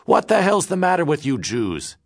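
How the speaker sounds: high, loud angry outburst; losing his temper. Acted. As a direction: snap at him in rage. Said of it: "Gus lost his temper".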